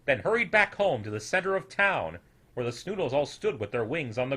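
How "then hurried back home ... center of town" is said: ordinary delivery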